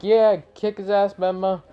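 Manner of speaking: high-pitched mock cheer; cheering crowd. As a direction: cheer him on like an onlooker.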